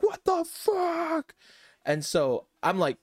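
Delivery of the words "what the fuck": very high, slow, stretched-out disbelief; mock screaming amazement. Friends watching the accident from down the street, screaming amazement. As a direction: imitate hyped friends squealing in shock. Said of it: "just started screaming".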